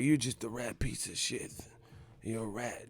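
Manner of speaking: softly imitated angry boxer; low-volume mock menace; Mike Tyson. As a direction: insult him under your breath, mimicking the fighter. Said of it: "if you had an angry Tyson".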